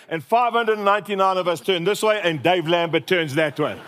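usual speaking voice

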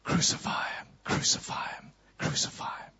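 distant whisper. Acted. Prisoner hears the crowd through the walls, whispered. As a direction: breathe it like a crowd heard far away. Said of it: "he hears is the crowd saying".

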